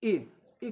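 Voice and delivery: "ए" said as a short i, as in sit; short i sound. Shown as a demonstration. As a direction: demonstrate "ए" as a short i vowel. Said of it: "छोटी ई कब बोला जाता है". ("ए" को छोटी इ जैसा बोलें)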